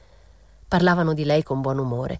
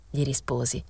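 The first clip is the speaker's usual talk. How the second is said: plain reading voice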